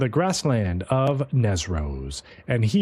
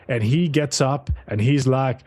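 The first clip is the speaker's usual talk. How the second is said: normal talking voice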